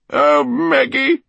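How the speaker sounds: high, loud, slow wail; drawn-out, high-pitched despairing call; anguished lament. A narrator's reading. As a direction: cry out to someone absent. Eleanor's anguished thought in the cellar.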